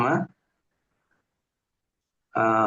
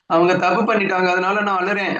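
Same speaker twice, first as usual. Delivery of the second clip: higher and louder, a put-on tearful complaint; mock whiny excuse; 6 semitones higher, 4 dB louder than usual. Disciple whining to the teacher about why he cries, in a mock tearful voice. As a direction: play the sulking disciple blaming others.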